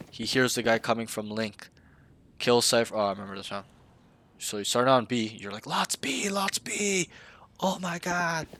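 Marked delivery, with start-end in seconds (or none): 5.7-8.5 much higher, mock panicked callout; exaggerated panic. Coach mocking the player's panicked callout on B, hammed-up high. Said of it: "Not like that, but I'm over-exaggerating".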